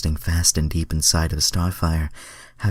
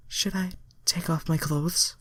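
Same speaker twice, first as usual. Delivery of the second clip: high, hammed-up girly question; mock innocent high voice; high Starfire voice; 12 semitones higher than usual. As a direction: ask it high and coy. Reader voices Starfire's dialogue.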